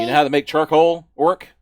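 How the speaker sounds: loud, fast, testing; orc drill boss. Acted. Orc boss quizzing the recruit on charcoal, loud. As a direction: grill the new recruit.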